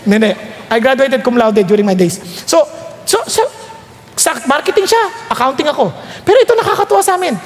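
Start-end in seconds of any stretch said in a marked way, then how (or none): none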